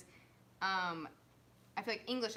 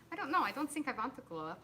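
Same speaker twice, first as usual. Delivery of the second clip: put-on German accent on a film line; German accent recalled from a film; 4 semitones higher than usual. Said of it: "That's my German line".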